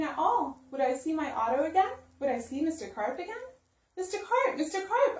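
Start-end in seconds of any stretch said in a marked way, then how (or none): 4.0-5.2 high calling out; yelling. Acted. Boy calling for the vanished Mister Carp, high call. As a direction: call out across the pool. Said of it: "I yelled".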